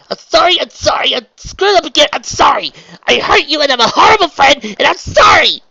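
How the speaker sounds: very high, loud wail; distraught apology. A narrator's reading. Trixie apologises to Raindrops through tears, wailing. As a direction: burst out as if close to tears.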